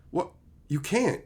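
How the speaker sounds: normal talking voice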